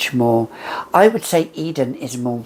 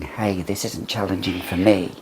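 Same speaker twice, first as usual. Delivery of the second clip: ordinary talk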